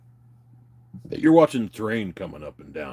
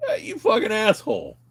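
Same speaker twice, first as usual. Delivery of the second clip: indignant outburst; 12 semitones higher than usual. Retold or imitated. Watching the footage, calling his friend an asshole, high and indignant. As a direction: yell back at the screen. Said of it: "I'm like".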